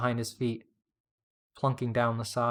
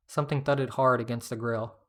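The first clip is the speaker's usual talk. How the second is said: ordinary delivery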